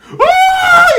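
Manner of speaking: high loud woo of pride; excited whoop. Real, not acted. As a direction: whoop like you just got respect. Hyped at being called brave.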